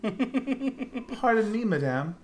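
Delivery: high, slow, delicate princess; drawn-out courtly lady's greeting; dainty princess voice. Acted. Reading heroine line in voice.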